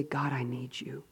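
soft, whispered plea; a humble whispered prayer. Pretend. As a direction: whisper it to God. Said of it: "where we say".

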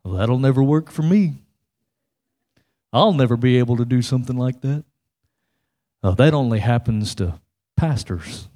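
low, gloomy defeatist voice; Eeyore. Acted. Preacher voicing the most negative guy, low and glum. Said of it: "Eeyore's like".

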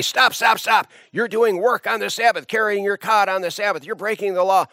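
high, sharp scolding voice; mock indignant religious leaders. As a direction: stop him and scold him for breaking the law.

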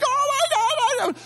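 very high-pitched mock little-girl squeal; little girl scream. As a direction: panic like a little girl, played for laughs. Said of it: "I'm screaming like a little girl".

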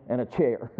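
ordinary talk, nothing marked

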